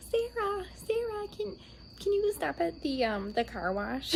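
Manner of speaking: high, sweet pleading mom voice; imitating mom. Imitating mom asking her daughter for the car wash, high pleading. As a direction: ask like a coaxing mother at night. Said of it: "she's like".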